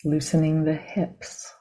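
calm, measured meditation guidance with long pauses; guided meditation. Real, not acted. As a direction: guide them gently into the body, leaving silence between phrases.

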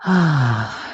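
long sighed "ah", falling away; relaxing sigh. Shown as a demonstration. Teacher showing students how to relax, a releasing sigh. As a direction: let the breath out in an easy sigh. Sighs out while guiding release.

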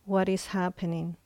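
usual speaking voice

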